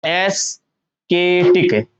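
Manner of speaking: एस्केटिक very slowly as "es... kay-tik"; wrong K pronunciation. Shown as a demonstration. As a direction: sound out एस्केटिक with a hard k, in pieces. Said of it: "ऐसे तो आप इसको पढ़ोगे". (एस्केटिक को "के" के साथ तोड़कर धीरे बोलें)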